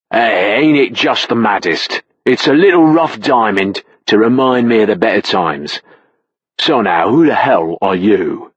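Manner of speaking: put-on slurring British pub accent; lightly voiced British drunk, slowed. Drunk Brit at the bar answers the narrator, British accent. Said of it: "I know his accent was legit".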